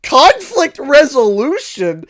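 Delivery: high, loud, slow disbelief; drawn-out, laughing-on-the-edge disbelief; incredulous. Retold or imitated. Incredulous reaction to the award.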